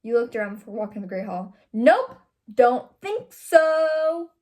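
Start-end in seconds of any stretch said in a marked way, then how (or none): 1.7-4.3 loud, slow "Nope, don't think so" with a long high "so"; popping the O. A narrator's reading. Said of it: "Popping the O".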